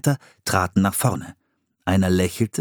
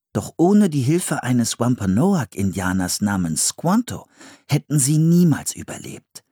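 plain reading voice

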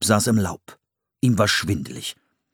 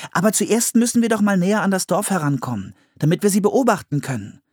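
put-on high, eager little-girl voice; Anne's girl voice; 11 semitones higher, faster than usual. Anne urging her brother to get closer. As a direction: play the bold younger sister.

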